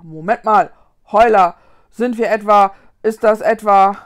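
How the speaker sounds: loud, halting ghost in rising alarm; alarmed realization. Acted. Ghost asks his companion where they are, alarmed. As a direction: break off as the dread dawns.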